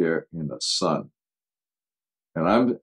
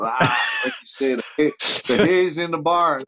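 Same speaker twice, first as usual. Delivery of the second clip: boisterous shout; 10 semitones higher than usual. Retold or imitated. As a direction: brush him off with a big hearty yell. John brushing off coach, shouting.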